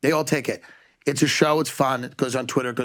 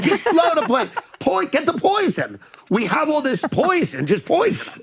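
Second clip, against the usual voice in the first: excited plotting; 7 semitones higher than usual. Retold or imitated. Russian officials plotting.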